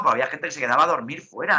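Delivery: ordinary talk, nothing marked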